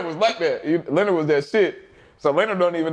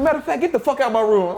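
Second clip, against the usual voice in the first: high-pitched mock outburst; retold coach's exasperated dismissal; imitating fed-up coach; 10 semitones higher than usual. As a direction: throw someone out in a raised-pitch coach impression. Retelling angry coach throwing him out.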